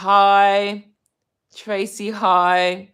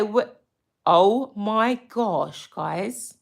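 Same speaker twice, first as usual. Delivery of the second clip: ordinary talk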